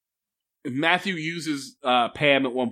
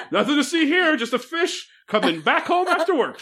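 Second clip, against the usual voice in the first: goofy fish voice; 12 semitones higher than usual. Pretend. Imagined fish sneaking in.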